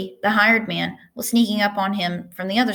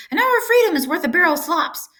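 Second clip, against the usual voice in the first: shouting goose; 10 semitones higher than usual. A narrator's reading. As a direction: squawk orders like a bossy goose. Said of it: "honked the goose".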